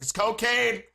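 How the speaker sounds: loud, high exasperated yell; mock yelling. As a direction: yell it as if not understood. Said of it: "You're yelling".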